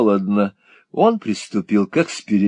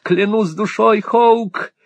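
much higher, breathless raving; smitten young lord; 11 semitones higher, slower than usual. A narrator's reading. Lovesick lord praising the girl to Hawk. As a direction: rave about her like a lovesick boy.

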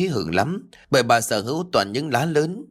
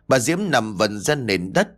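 ordinary delivery, nothing marked

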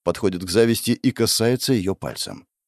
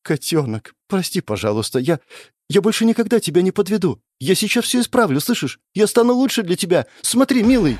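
manic promises; 9 semitones higher than usual. Acted. Goddess promises beloved.

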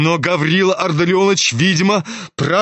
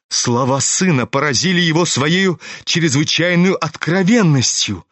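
usual speaking voice

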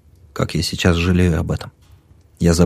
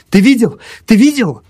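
high, raised insistent question; urgent demand; 13 semitones higher, 5 dB louder than usual. A narrator's reading. Narrator demands his friend confirm it, urgent. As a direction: press him for an answer, agitated.

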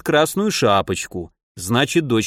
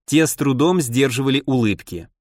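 ordinary delivery, nothing marked

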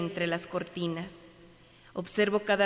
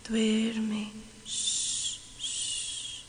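whispering over a crib; 8 dB quieter, much slower than usual. Acted. Lulling to sleep.

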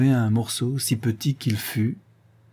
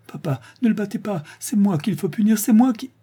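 very high, breaking pleading voice; the girl begs her father not to hit Ulrich, sobbing high; sobbing plea; 11 semitones higher than usual. A narrator's reading. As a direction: beg through tears, rising. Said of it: "s'écriait Polly en sanglotant".

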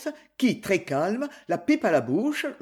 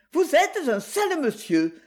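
higher-pitched, disgusted reproach; indignant retort; 7 semitones higher than usual. A narrator's reading. Ruby confronts Tonio after the slap, indignant retort. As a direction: stand up to him with scorn. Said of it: "lança-t-elle".

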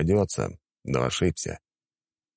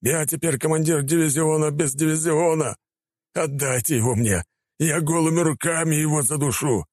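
furious rant; 11 semitones higher than usual. Acted. Attacker rants to the commander, furious. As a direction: rage at the loss.

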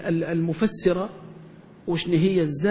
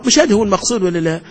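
ordinary talk, nothing marked

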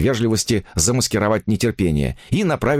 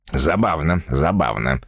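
low, tight-jawed remark; through gritted teeth; 5 semitones lower than usual. A narrator's reading. Shocked writer hides his feelings, low through gritted teeth. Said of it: "произнёс он сквозь зубы".

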